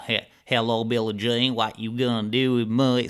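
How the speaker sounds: put-on southern hillbilly accent. Teasing a partner after watching Ozark, hillbilly accent. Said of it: "kinda hillbilly accent".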